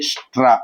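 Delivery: స్ట్రా with sh, ట and rolled రా joined; three-sound cluster. Shown as a demonstration. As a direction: blend it all into one syllable, స్ట్రా. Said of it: "రా కూడా పలికామనుకోండి".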